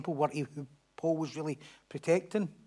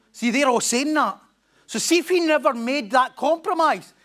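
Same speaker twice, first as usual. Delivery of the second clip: loud, high, slow emphatic preaching; raised preaching voice; 7 semitones higher, 6 dB louder than usual. Real, not acted. Preacher stirring the congregation, loud and high. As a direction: lift it high and slow to drive the point. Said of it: "preacher raising voice".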